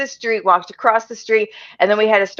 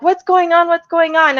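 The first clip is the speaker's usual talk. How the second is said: high-pitched worried questioning; retold alarm; 9 semitones higher than usual. Housemates question the shaking runner, alarmed. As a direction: imitate alarmed onlookers, insistent.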